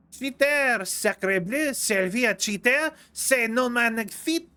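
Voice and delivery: silly stage-French scolding; hammed-up French accent. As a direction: lay on a cartoon French accent. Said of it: "be done with the French accent soon".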